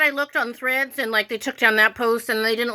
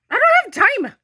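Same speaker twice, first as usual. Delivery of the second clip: higher and louder, fed up; exasperated outburst; 11 semitones higher, 5 dB louder than usual. Real, not acted. To viewers about spam flags, exasperated outburst. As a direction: snap it out like you've had enough. Ranting about being called spammer.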